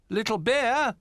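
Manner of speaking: high, loud call across the room. A narrator's reading. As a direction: call out searching for him. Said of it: "called Bramble".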